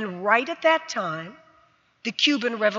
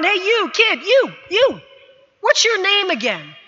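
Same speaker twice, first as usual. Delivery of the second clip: yelling coach; 7 semitones higher, 4 dB louder than usual. Retold or imitated. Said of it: "he yelled down".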